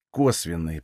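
subdued, uneasy reply; wincing admission. A narrator's reading. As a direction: admit it quietly with a wince. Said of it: "поморщился я".

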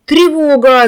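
loud alarm call; shout. A narrator's reading. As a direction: raise the alarm, loud and high. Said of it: "закричал Лёша Михайлов".

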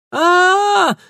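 loud, high falling scream. Acted. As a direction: scream as if tripped at full run. Girl falls, screaming. (пронзительный крик)

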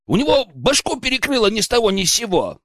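high aggrieved protest; indignant. A narrator's reading. Accused lad blames his mate for the fight, indignant. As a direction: push the pitch up in outrage. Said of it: "возмутился Глеб".

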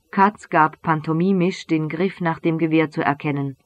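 usual speaking voice

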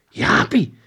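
high, loud startled call; almost scream the name; sudden exclamation, barely held back; near shout. A narrator's reading. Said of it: "schreeuwde Monica bijna".

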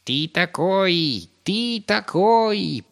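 very high, loud, drawn-out taunt; growling rat. A narrator's reading. Rat Fuchuka accuses Pip, growling taunt. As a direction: stretch the jeer out slowly at high pitch. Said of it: "прорычала Фучука".

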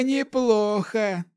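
slow, high amused praise, falling; laughing old hermit. Acted. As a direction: savor it, amused. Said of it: "рассмеялся отшельник Вэй".

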